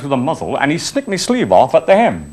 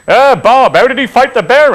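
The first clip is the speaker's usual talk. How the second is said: teasing call; 9 semitones higher, 6 dB louder than usual. Retold or imitated. Townsfolk teasing Bob Saunders.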